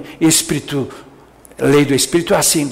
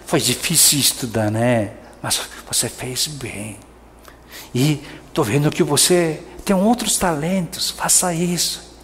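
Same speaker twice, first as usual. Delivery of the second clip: lower, softer, kind parent reassuring; gentle encouragement; 4 semitones lower, 6 dB quieter than usual. Retold or imitated. Parent gently encouraging child with low grade, softer and lower. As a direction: comfort the child softly. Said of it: "falar assim".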